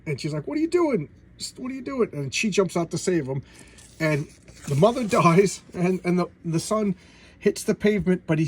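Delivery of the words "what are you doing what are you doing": high-pitched mock panic; high, alarmed cry, acted out; imitated alarm. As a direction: imitate a startled woman, pitch leaping way up. Voicing woman alarmed at his jump.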